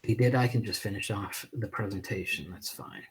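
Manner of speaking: muttered away from the mic; quietly sorting a tech glitch off to the side; off-mic aside. Real, not acted. Host aside to a co-host while reconnecting, muttered off-mic.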